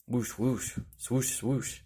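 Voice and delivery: ordinary delivery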